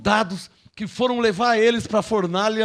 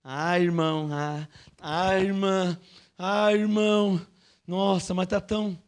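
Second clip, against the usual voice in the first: mock whiny weak believer; 5 semitones lower, 5 dB quieter, slower than usual. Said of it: "tem gente que qualquer ventinho já".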